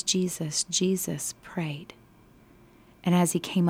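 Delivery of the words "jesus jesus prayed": slow, soft whisper of Jesus prayed; hushed. Shown as a demonstration. Key phrase slowed and hushed.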